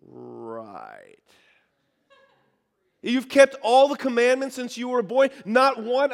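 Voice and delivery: sly villain sneer, then incredulous and loud; mock Doctor Evil disbelief. Jesus doubting the rich man, hammed-up Doctor Evil sneer. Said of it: "a Doctor Evil".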